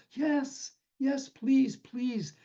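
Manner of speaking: high pleading voice; trapped man begging the doctor to help; desperate plea. A narrator's reading. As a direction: beg the doctor for rescue.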